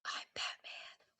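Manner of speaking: hushed gravel-free Batman whisper, slow. Acted. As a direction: breathe it out slowly like the Dark Knight. Quoting Batman's famous line.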